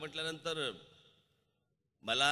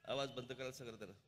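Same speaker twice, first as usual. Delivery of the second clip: quiet aside; 10 dB quieter than usual. Real, not acted. Aside to staff mid-speech.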